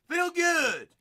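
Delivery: loud, high holler; calling out. Real, not acted. Host hailing Doctor Feelgoods, calling out.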